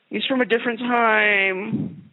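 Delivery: sing-song it like a naive apologist; slow, high, silly mimicry of people excusing bigots; mocking whiny excuse.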